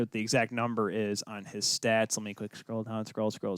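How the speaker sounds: muttering to himself. Real, not acted. Mumbling while scrolling stats.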